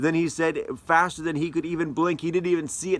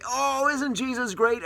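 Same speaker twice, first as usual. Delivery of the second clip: high, sing-song mock piety; mock churchy gush; 9 semitones higher than usual. Preacher mocks Sunday-only faith with high, gushing mock praise. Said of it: "take him out and say".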